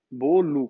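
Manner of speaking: bolo slowly as "BOH-loo"; final O as U. Shown as a demonstration. Teacher repeating the normal form, bolo ending in "oo". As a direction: let bolo end clearly in "oo". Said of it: "com U no final".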